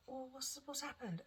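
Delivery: high, soft worried question; retold concern. Retells her alarmed question to husband.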